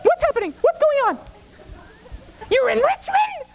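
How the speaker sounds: very high shrieking mother voice; hysterical mom. Retold or imitated. Frantic mother on the phone to her drunk son, very high.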